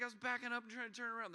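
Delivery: blurting it out in a panic; panicked daughter. Retold or imitated. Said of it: "It's like".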